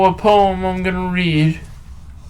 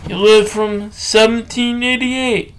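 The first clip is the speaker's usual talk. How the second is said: ordinary delivery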